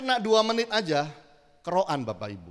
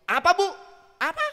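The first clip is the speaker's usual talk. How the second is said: "Apa, Bu? Apa?" snapped much higher and louder, the second "Apa" shooting up; rude intonation; 9 semitones higher, 5 dB louder than usual. Shown as a demonstration. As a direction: bark the question back at her rudely. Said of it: "Karena intonasi".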